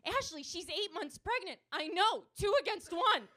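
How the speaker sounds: loud, high comic back-and-forth; frantic arguing. Acted. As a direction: argue in mock panic. Frantic argument during shooting story.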